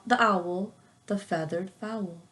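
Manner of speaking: usual speaking voice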